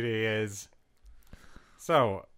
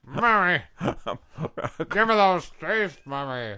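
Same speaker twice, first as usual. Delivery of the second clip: [name] impression; 4 semitones higher, 3 dB louder, slower than usual. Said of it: "more like [name]".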